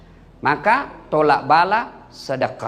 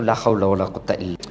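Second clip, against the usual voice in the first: deep exasperated sigh; 10 semitones lower, faster than usual. Acted. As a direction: drop the voice low and quick, mock despair. Mock despairing exclamation. (seruan putus asa yang dalam dan berat)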